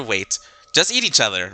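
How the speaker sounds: ordinary talk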